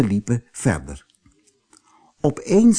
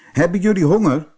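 quicker, higher rabbit voice; rabbit's question; 7 semitones higher, 3 dB louder, faster than usual. A narrator's reading. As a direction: ask brightly, pitched up and fast. Rabbit's line in story.

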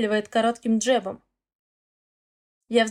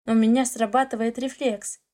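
plain reading voice

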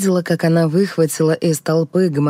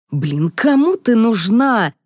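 slow, higher-pitched scornful question; rude blurt; 6 semitones higher, slower than usual. A narrator's reading. Stressed man forgetting his manners, rudely dismissive. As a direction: blurt it out scornfully, stretching the words. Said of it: "брякнул тип в джинсах".

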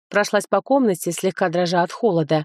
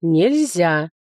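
firm book voice. Acted. Book forbids.

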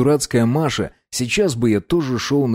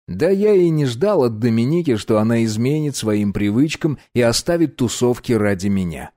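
ordinary delivery

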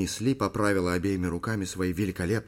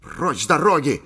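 loud, raised order to clear off; forceful command; 6 semitones higher, 8 dB louder than usual. A narrator's reading. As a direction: bellow it to scare off a beast. Man drives off the beast. (грозный громкий приказ)

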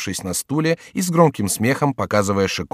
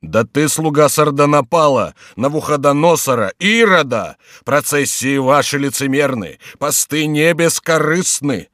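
higher, emphatic accusing monk; indignant character voice, pitched up; denouncing monk; 5 semitones higher, 4 dB louder than usual. Acted. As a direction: hurl each charge like a preacher.

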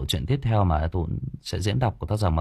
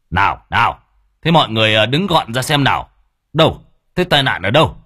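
put-on louder commanding old man voice; bossy village chief; 4 semitones higher, 8 dB louder than usual. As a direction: clear the crowd with authority. Long pushes through crowd. (ông trưởng thôn ra lệnh dẹp đám đông)

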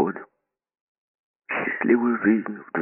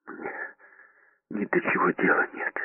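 breathless, unvoiced whisper; out of breath whisper; 12 semitones higher, 4 dB quieter than usual. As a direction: gasp it out in a whisper. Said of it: "задыхаясь, прошептал он".

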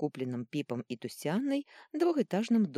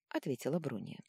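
ordinary delivery

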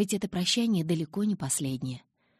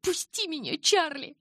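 much higher, loud yell; 12 semitones higher, 3 dB louder than usual. A narrator's reading. Bella yells at Charlie to let her go, much higher. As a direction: roar it as she tears the door open. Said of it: "заорала я".

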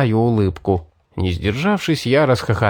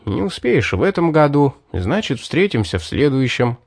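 ordinary delivery

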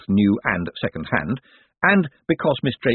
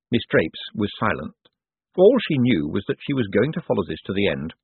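usual speaking voice

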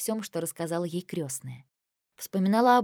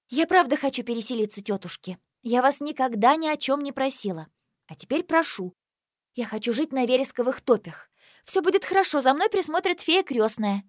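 rehearsed pleading speech; 6 semitones higher, 4 dB louder than usual. Acted.

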